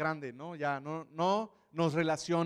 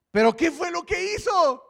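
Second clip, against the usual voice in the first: demanding shout; 12 semitones higher, 10 dB louder than usual. Acted. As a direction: shout the question high and loud. Pharisees interrogate the healed man.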